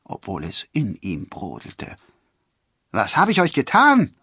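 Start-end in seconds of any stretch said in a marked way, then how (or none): 2.9-4.1 high, loud question; indignant protest. A narrator's reading. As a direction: demand an explanation, voice raised. Robin protests in the quarrel.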